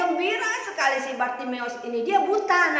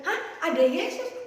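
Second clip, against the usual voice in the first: higher-pitched startled question; kaget; 5 semitones higher, 4 dB louder, slower than usual. Acted. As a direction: blurt it as surprised Bartimeus. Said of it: "si Bartimeus kaget". (kaget, nada naik)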